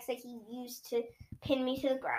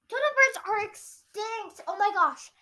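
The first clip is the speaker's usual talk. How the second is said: higher, amazed exclamation; gasping amazement; 8 semitones higher than usual. Acted. Iowa amazed to see an extinct bird. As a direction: blurt it out in wonder. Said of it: "Iowa gasped".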